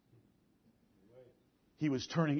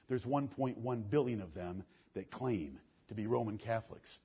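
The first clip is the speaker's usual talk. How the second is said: ordinary talk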